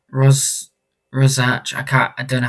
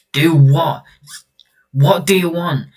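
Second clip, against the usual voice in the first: louder, higher challenge; acted exasperated demand; 7 semitones higher, 11 dB louder, slower than usual. Parent confronting stranger about the job, exasperated raised voice. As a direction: push the questions up, demanding answers.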